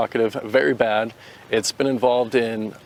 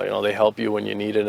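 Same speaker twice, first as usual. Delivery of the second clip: ordinary talk, nothing marked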